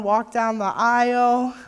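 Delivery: ordinary talk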